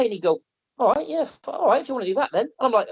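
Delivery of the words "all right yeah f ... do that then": Doug's reply. Retold or imitated. Doug's reply voiced in story.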